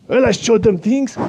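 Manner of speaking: put-on a proud Eastern European accent, voice pushed up high; Slovak accent. Anton gloats about Slovakia's covid numbers in a high Slovak accent.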